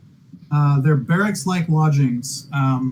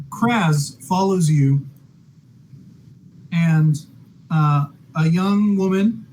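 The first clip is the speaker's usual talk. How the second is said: normal talking voice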